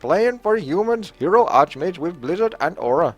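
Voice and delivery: high, lilting Indian accent. Shown as a demonstration. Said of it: "do this in an Indian accent".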